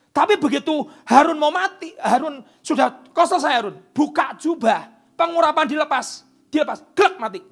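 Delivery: high, loud preaching; fiery preacher climax; preaching at full force. Real, not acted. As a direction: build it to a roar. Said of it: "preaching at full force".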